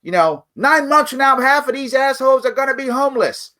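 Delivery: high angry army yell; mock shout of a mad soldier; retold yell. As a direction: bark it like a furious young officer. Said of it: "And he yelled this".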